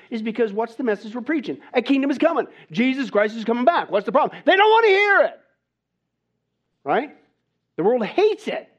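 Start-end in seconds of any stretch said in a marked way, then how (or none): 4.4-8.7 loud, much higher shout on wanna; preacher's shout. Acted. Preacher crying out that the world rejects the message, loud shout. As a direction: belt it out, indignant.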